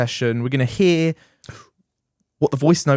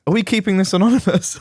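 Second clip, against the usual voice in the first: ordinary delivery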